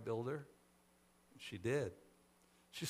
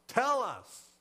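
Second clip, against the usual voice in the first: high, loud demanding shout; insistent yell with a falling end; 9 semitones higher, 9 dB louder than usual. A narrator's reading. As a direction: demand it forcefully of a stranger. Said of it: "demanded a third".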